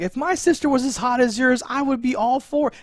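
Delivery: high-pitched, loud impression; Donnie. Imitating Donnie's blurted confession.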